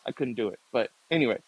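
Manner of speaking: ordinary talk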